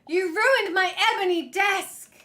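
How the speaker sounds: high, loud laughing wail; hammed-up cry over the desk; wailing about the ruined ebony desk; mock wail.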